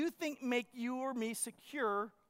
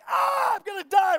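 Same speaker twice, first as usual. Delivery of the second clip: loud, high panicked cry of a man under attack; retelling a wasp sting, shouting in sudden panic; panicked yell; 8 semitones higher, 11 dB louder than usual. Retold or imitated. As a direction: yell it like you think you're about to die. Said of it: "And I panic".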